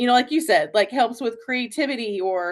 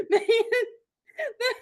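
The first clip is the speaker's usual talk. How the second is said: high, laughing through his correction; real laughter over a mock husband; laughing husband quote; 9 semitones higher than usual. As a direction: quote him while cracking up. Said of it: "when he's like".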